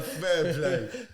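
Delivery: giving in with a laugh; laughing concession. Retold or imitated. Conceding to the Daily Mail headline with a laugh.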